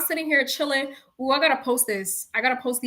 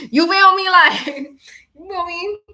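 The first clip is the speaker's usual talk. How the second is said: laughing; 6 semitones higher, 7 dB louder than usual. Real, not acted. Laughing emphatic aside.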